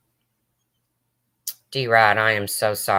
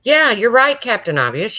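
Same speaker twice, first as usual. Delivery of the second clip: sarcastic retort; 10 semitones higher than usual. Real, not acted. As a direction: needle him with sarcasm. Snapping back at a chatter.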